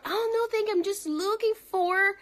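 high-pitched polite decline in English; polite customer. Acted. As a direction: act out a shopper brushing off the clerk. Acting out polite customer in store.

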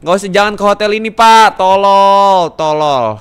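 loud, fast, high warning; exasperated shout. Pretend. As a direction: yell a guest away, stretching the insult. Yelling guest away from hotel.

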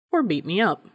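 plain reading voice

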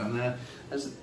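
ordinary talk

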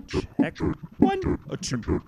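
high processed mic check; pitch shifted voice. Shown as a demonstration. Mic check through effects unit.

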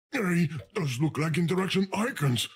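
normal talking voice

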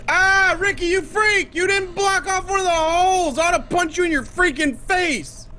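play-angry rant, pitch way up; mock rage. Yelling at friend Ricky over the holes, hammed-up rage. Friend left a hole, he rages.

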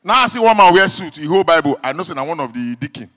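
normal talking voice

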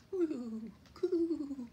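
high, drawn-out cooing; dove coo. A narrator's reading.

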